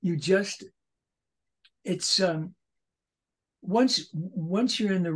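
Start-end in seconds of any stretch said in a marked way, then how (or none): none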